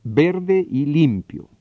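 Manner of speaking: slow, deliberate, each word set apart; patient, slow repetition for a young son; slow drilled phrase. Retold or imitated. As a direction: spell it out slowly for a child. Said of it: "Me tomó dos palabras y dos semanas".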